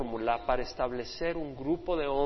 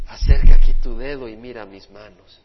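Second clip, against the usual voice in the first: much louder, lower command; commanding call; 5 semitones lower, 20 dB louder than usual. Acted. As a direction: call Thomas forward with full force. Jesus addresses Thomas.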